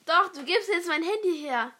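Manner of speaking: forceful, loud command; loud demand. Real, not acted. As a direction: demand it loudly. Demanding phone back.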